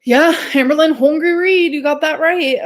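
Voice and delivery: loud, high, playful call-out; mock declaration. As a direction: belt it out in mock agreement.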